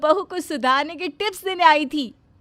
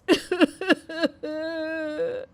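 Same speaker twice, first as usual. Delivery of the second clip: crying; 2 semitones higher, 5 dB quieter than usual. Acted. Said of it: "सास रो रो कर".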